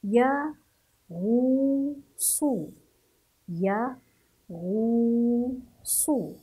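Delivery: "يا، غو، صو" held, with a pause after each; syllable by syllable. Shown as a demonstration. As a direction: sound out "يا، غو، صو" as separate long syllables. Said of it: "هذا هو نطق حرف الغين مع الضم". (تهجئة "يا، غو، صو" مقطعًا مقطعًا)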